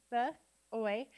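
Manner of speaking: B-oy broken into two sounds, b, then oy; sounded out. Shown as a demonstration. Teacher sounding out a word with students, B-oy split into sounds. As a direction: give B-oy as separate sounds with a pause. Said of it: "sound it out with me".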